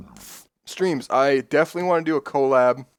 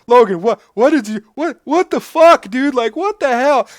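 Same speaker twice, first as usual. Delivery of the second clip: imitating flabbergasted disbelief, stammering, high and loud; Phil freaking out; 12 semitones higher, 9 dB louder than usual. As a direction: splutter it loud and high, mock shock. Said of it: "he's like".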